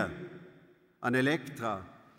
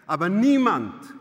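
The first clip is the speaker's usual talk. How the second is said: loud, raised-pitch protest; impassioned outburst; 4 semitones higher, 9 dB louder than usual. A narrator's reading. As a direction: burst out in fervent defence. Young maid's impassioned defence of Elektra. (leidenschaftlich und laut ausgerufen)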